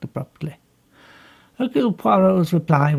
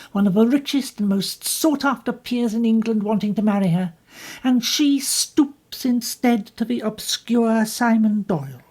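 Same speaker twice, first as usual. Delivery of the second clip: higher, bitter and defensive; bitter young woman; 6 semitones higher, 3 dB louder than usual. Acted. Jacqueline bitterly tells Poirot what happened. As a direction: push back at him with bitterness.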